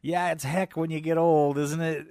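mock shout across a parking lot; retold yell. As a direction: holler it like a stranger teasing from his truck. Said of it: "he yells across the street".

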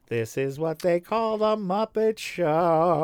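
slow announcer voice. Pretend. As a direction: announce it like a TV intro. Mock announcer intro of the show.

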